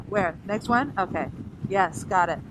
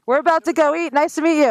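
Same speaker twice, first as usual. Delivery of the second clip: friendly raised voice; 8 semitones higher, 7 dB louder than usual. Real, not acted. Talking to a stranger at parking.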